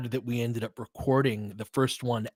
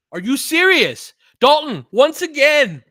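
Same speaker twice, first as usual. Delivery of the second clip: much higher, louder and slow; excited disbelief; 10 dB louder than usual. Real, not acted. Fan watching his player score again, thrilled disbelief. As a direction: shout it in happy disbelief.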